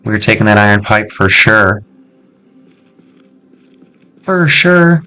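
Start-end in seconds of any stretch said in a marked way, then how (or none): 4.2-5.0 silly high voice. Pretend.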